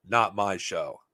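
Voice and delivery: ordinary talk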